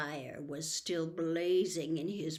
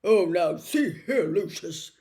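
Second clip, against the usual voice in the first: a flustered outburst, louder; a nervous official caught off guard; alarmed; 8 dB louder than usual. Acted. Fudge protests to Lucius, alarmed and flustered. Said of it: "looking alarmed".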